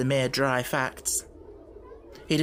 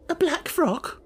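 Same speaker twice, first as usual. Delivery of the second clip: much higher, faltering echo; bewildered stammer; 14 semitones higher, slower than usual. Acted. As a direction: repeat it in confusion. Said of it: "Miss Amelia stammered again".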